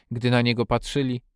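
ordinary delivery, nothing marked

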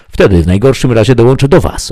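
loud, high retort; shouting back. A narrator's reading. Ikelos answers his companion's warning, shouting back. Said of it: "odkrzyknął".

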